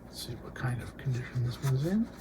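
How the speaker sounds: real whisper while checking the record, unvoiced breath only. Real, not acted. Record collector inspecting a disc, whispering to himself. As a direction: lean in and whisper it to yourself.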